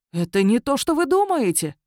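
protest, rising high; indignant, hurt self-defence; sincere indignation. A narrator's reading. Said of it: "искренне возмутился Божидар". (искренне возмущённо)

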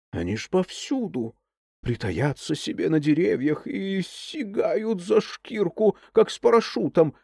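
hushed spooky tone. Acted. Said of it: "понизив голос".

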